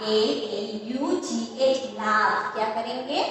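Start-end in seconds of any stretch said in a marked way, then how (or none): 0.0-1.8 slow spelling. Shown as a demonstration. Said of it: "इसका pronunciation हम क्या करेंगे?".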